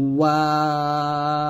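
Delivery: "ওয়াও" very drawn out, lips rounded but not closed; gap between lips. Shown as a demonstration. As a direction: hold a long "ওয়াও" without the lips touching. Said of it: "ঠোঁটের মাঝে ফাঁকা থাকবে".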